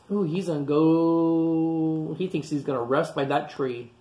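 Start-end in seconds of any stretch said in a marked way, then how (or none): none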